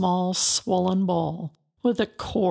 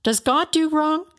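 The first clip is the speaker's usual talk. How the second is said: indignant challenge; 9 semitones higher, 4 dB louder than usual. Acted. Bildad rebukes Job.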